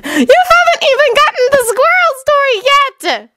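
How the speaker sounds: very high, indignant protest; aggrieved, high-pitched outburst; groaning exasperation. Acted. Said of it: "Sakura groaned".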